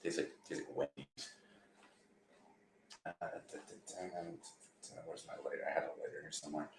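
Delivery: near-silent murmur while fumbling for a lighter; under-the-breath mumbling, half whispered; muttering to himself. Real, not acted. Lighting a cigarette, muttering aside.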